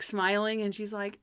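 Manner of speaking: usual speaking voice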